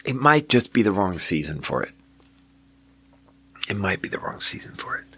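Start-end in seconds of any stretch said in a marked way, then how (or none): 3.7-5.0 quiet, half-whispered musing; under the breath. Real, not acted. Reviewer second-guessing the bad drink, muttered under the breath. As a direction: softly repeat it to yourself, almost whispered.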